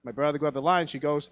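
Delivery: usual speaking voice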